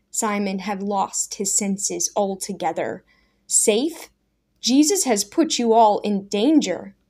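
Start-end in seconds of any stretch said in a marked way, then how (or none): 3.5-4.1 sharp rising disbelief, a bit louder; Daniel echoing Simon in disbelief; incredulous echo. Acted. 4.6-7.0 heated objection. Acted. Daniel objects hotly.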